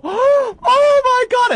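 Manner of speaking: very high, loud and slow, stretched out; mock overjoyed gasp. Imitating a TV bride's proposal reaction, overjoyed shout. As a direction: ham up a proposed-to girl's shocked joy. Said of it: "put their hands in front of their mouths and go".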